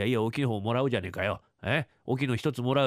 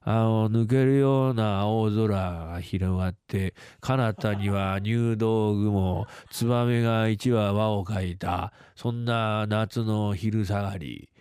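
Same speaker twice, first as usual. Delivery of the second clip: slow, lower, lyrical reverie; dreamy Yotaro; 4 semitones lower, much slower than usual. Acted. Yotaro describing the sky he looked at, slow and dreamy. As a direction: paint the summer sky slowly like a poem.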